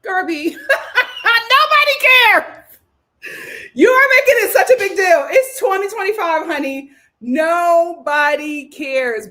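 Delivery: high, loud, drawn-out holler; laughing mock scolding. Viewer telling Garvey it's no big deal, mock-exasperated holler. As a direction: holler in amused exasperation, stretching words.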